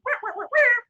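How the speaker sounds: high, loud trumpet-like wahs. Retold or imitated. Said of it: "all I hear is".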